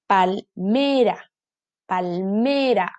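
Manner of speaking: "palmera" drawn out slowly, pal-ME-ra; stressed middle syllable. Shown as a demonstration. Teacher helps children find the tonic syllable, "palmera" slow with ME stressed. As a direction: break up "palmera" and hold the ME. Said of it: "Aquí está su sílaba tónica".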